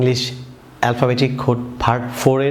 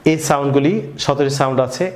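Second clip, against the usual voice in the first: ordinary talk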